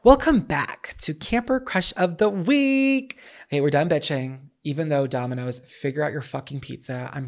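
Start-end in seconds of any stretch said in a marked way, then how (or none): 0.0-3.2 higher, slow segment intro; drawn-out welcome into the next segment; announcer. Acted. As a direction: present it like a show host.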